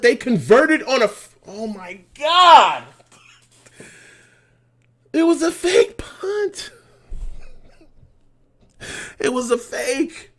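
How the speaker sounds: loud, high, crying-out fan despair; anguished wail. Real, not acted. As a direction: wail over the fake punt like it hurts. Fan sees fake punt succeed.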